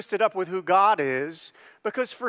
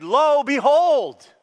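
slow, high, loud announcing voice; archaic proclamation; 8 semitones higher, 8 dB louder, much slower than usual. Shown as a demonstration. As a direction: proclaim Lo, behold grandly. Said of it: "It can be translated".

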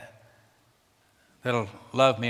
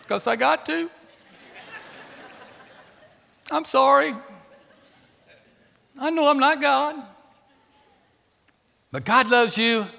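emotional; 3 dB louder than usual. Real, not acted. Emotional confession.

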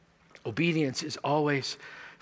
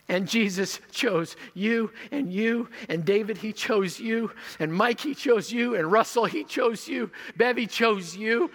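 each name louder and higher; building preaching; 6 semitones higher, 3 dB louder, slower than usual. Acted. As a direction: build to a full-force call, naming each person. Names congregation one by one.

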